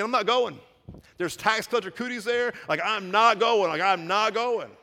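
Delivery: put-on stubborn kid refusing to go; petulant refusal. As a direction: Peter sulking about cooties. Peter refusing like a child.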